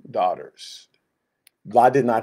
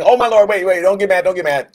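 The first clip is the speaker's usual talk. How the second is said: louder, much higher flustered begging; panicked pleading; 8 semitones higher, 8 dB louder than usual. Acted. Moses panicking in front of God, loud and pleading. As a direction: beg fast and loud, wait, wait.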